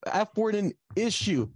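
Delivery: indignant retelling to the supervisor; retold frustration. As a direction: vent it, pitched well up. Retold outburst at academic supervisor.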